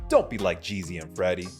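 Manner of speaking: usual speaking voice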